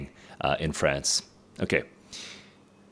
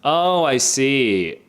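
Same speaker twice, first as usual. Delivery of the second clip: a loud, drawn-out dawning oh; slow, loud aha as it finally clicks; realization; 6 semitones higher, 6 dB louder, much slower than usual. Real, not acted. Sudden realization while reading.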